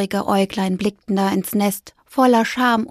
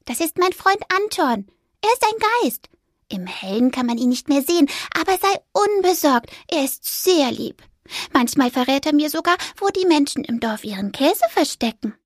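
lightly voiced mouse; 6 semitones higher than usual. A narrator's reading. Fipper explaining.

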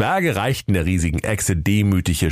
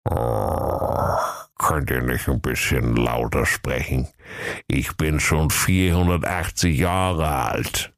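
put-on low, slow old tortoise voice; ancient oracle tortoise; 5 semitones lower than usual. Hard-of-hearing tortoise answers the dwarfs, old and ponderous. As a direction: take your time, an age-worn low voice.

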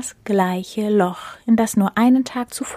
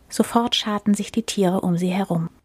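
plain reading voice

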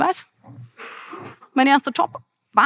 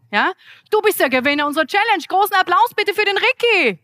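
ordinary delivery

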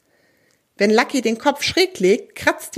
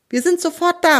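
calling out; 8 semitones higher than usual. A narrator's reading. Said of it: "ruft Benny".

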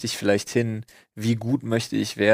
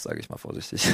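whispered aside; 11 dB quieter, faster than usual. Real, not acted. Cautious aside after a provocation.

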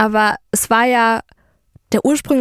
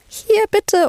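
much higher, clipped and dismissive; curt handover; 10 semitones higher than usual. Retold or imitated. Handing the ex his books, a cold high curt reply. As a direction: shove the bag at him coolly. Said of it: "Und ich bin so".

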